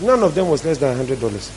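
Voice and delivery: ordinary talk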